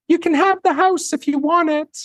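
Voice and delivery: imitating Netta offering the house, high sing-song. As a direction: float it up high like kind news. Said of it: "she kinda talks in a sing-song voice like this".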